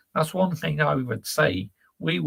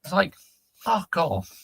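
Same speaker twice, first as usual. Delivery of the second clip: higher, exasperated snap of a rant; 7 semitones higher than usual. Real, not acted. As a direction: let the irritation burst out. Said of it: "always having a rant about it".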